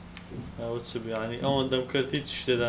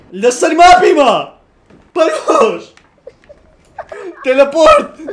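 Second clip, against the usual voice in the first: very loud, high hollering; wild gamer shouting at full volume; excited yelling; 19 dB louder than usual. Real, not acted. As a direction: belt each word out. Yelling during map glitch.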